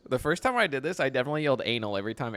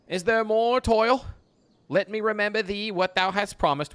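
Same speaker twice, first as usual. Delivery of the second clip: higher, louder stage reading; hammed-up Shakespeare reading; theatrical reading; 9 semitones higher, 4 dB louder, slower than usual. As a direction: launch into the line with flair. Reading Ariel's lines.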